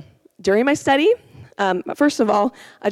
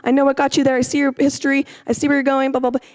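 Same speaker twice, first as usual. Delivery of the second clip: quick, higher-pitched data readout; mock data program; 7 semitones higher, faster than usual. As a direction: rattle it off like a computer processing facts. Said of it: "like a data program".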